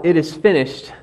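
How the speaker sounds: ordinary talk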